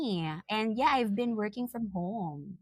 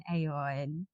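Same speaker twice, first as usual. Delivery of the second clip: ordinary delivery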